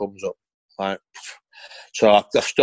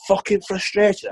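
angry; 9 semitones higher, slower than usual. Retold or imitated. Angry retort to manager.

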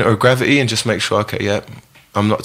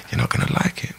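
hushed warning; 9 dB quieter than usual. Retold or imitated. Brando warns nephew in story.

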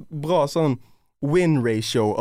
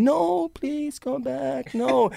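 much higher, very slow pleading wail; mock desperate scream; 12 semitones higher, much slower than usual. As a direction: call after the running guest, desperate. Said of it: "hører han jævel skrike bak meg". (høy, langtrukken bønnfallende rop)

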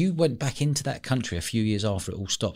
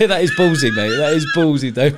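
real excited outburst, higher and louder; impressed; 6 semitones higher, 6 dB louder than usual. Real, not acted. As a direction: hype up the guest's nerve with real excitement. Host reacts to daring story.

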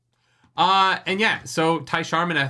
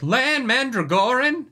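high, slow, surprised calling of the name; 10 semitones higher, 4 dB louder, slower than usual. Retold or imitated. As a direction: call down in amazed recognition. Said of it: "someone upstairs is like".

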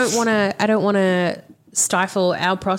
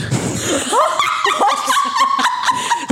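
high squealing laugh. Real, not acted. Laughing at own hot toothbrush.

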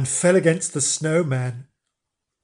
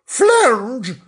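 loud, high wifely call; high, slow cry of disapproval; 12 semitones higher, 6 dB louder, much slower than usual. Acted. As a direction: call your husband sharply, stretching the question. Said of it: "she called".

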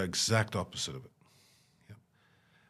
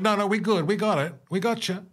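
high, loud mock reassurance; a light impression of a reassuring aid worker; 11 semitones higher, 5 dB louder than usual. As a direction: play the calm aid worker. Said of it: "So you're like".

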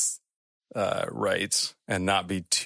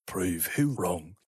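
gruff creature; 2 semitones lower, 4 dB quieter, much slower than usual. Acted. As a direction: question him slowly, pitched down. Voices the creature.

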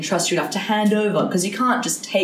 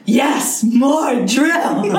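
gleeful movie quote; 6 semitones higher, 4 dB louder, much slower than usual. Retold or imitated. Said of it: "Steve Martin's dancing around".

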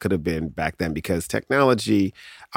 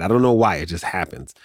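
ordinary talk, nothing marked

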